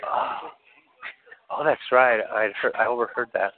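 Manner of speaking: usual speaking voice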